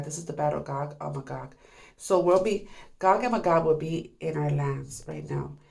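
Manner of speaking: ordinary delivery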